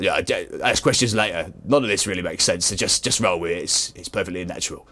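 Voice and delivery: a low, booming comic deep voice; Steve's deep voice. Acted. Steve answers Mother in his deep comic voice. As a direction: rumble it out like a big-voiced hero. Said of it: "got a deep voice".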